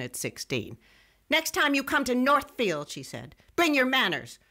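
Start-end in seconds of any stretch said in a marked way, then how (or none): 1.3-2.9 high, scolding big woman; a scolding grandmother voice; stern scolding. Retold or imitated. Grandma scolding Jesse James. 3.5-4.4 high, scolding big woman; a scolding grandmother voice; stern scolding. Retold or imitated. Grandma scolding Jesse James.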